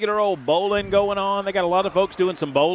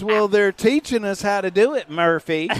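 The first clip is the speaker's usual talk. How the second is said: higher-pitched mimicry of a grumbling curler; Jackson; 4 semitones higher than usual. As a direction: imitate a defensive old Southerner. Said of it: "that's my Jackson impersonation".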